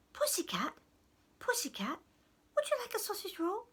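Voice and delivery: a hint of a wheedling goblin; coaxing goblin. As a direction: coax a cat, quickening on the offer. Goblin offers food to bear.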